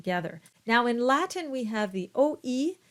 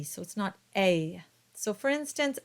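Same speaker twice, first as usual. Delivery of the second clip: ordinary talk, nothing marked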